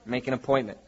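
low-key, offhand dismissal; matter-of-fact pastor fobbing someone off; casual brush-off. Retold or imitated. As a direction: say it as a routine brush-off. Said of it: "And I go".

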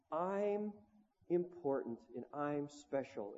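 slow, soft mock 'important and special'; breathy mock sincerity; soft self-affirmation. Woman on Oprah affirming herself, soft and slow. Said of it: "and just say".

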